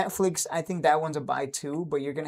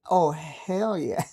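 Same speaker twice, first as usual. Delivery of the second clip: gleeful; much slower than usual. Retold or imitated. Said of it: "I woke up and I'm like".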